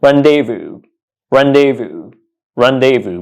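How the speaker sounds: "rendezvous" as RAN-day-voo; final S silent in "rendezvous", DAY in the middle; correct pronunciation. Shown as a demonstration. Teacher repeating the right way, "rendezvous" ending in VOO. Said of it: "The correct pronunciation of this word is".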